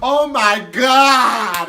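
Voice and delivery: long, drawn-out high wail; hammed-up horrified holler; mock scream. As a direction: scream it out slowly, stretching each word. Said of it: "I hear her scream".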